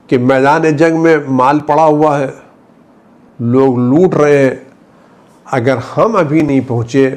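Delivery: ordinary talk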